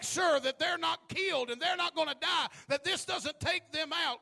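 loud rallying shout. Pretend. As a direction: shout it like a battle cry. Preacher voicing a rallying cry.

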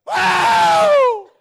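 sudden loud high whoa; a churchwoman's Holy Ghost shout; Martha's shout. Retold or imitated. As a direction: yell like you got stabbed in the ribs. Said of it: "she would just go".

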